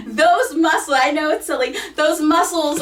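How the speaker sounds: giggling through the words; laughing. Real, not acted. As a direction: laugh while explaining, embarrassed. Laughs at her silly example.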